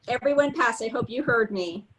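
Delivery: usual speaking voice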